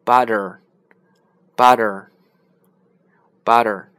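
loud, very slow American butter with a flapped t; American accent. Shown as a demonstration. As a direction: drill American butter slowly. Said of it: "但美国人呢发成".

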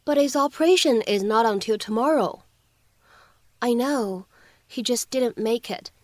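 ordinary talk

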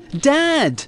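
high-pitched call for Dad; child yelling. A narrator's reading. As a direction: act a child calling out in alarm. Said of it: "he yelled".

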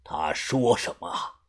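low quiet boss voice. Acted.

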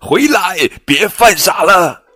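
high, urgent calling out. Acted. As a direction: call them back, a bit alarmed. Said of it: "其他象叫道". (高声喊叫)

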